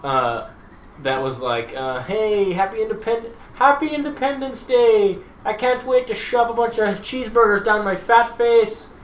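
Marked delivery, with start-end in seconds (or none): 2.0-8.9 high, loud mock cheer; mocking American. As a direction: ham up a loud cheering tourist. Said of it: "if a bunch of Irish people made a video".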